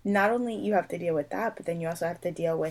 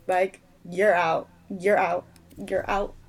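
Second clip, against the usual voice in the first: louder you're out, declaring exclusion; emphatic repetition; 5 dB louder than usual. Real, not acted. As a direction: drive it home each time. Repeated dismissal in rant.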